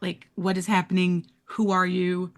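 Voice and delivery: ordinary delivery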